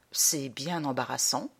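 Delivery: understated grief in a subdued voice; saddened. Acted. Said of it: "fis-je tout attristé". (légèrement attristé, à voix basse)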